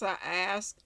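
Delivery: ordinary delivery, nothing marked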